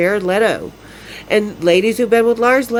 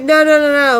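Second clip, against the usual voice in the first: high, loud no's; a fired-up retold refusal through the door; loud indignant refusal; 6 semitones higher, 4 dB louder than usual. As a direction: shut him down hard and indignant.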